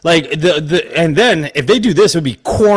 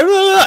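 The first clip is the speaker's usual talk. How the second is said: high mock shriek; mock scream. Acting out Gale's scene, a high mock shriek.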